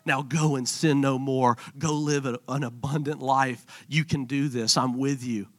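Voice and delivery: soft, low, gentle send-off; softly voiced blessing of God; tender blessing. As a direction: speak it like a quiet benediction. Pastor voicing God's blessing softly.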